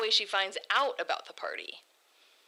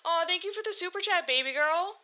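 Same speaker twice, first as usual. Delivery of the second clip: usual speaking voice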